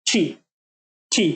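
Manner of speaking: টি said crisply as one stop; demo sound. Shown as a demonstration. Teacher modelling the new টি sound, crisp. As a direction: clip টি off cleanly. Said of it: "just এটাকে টি বলবো".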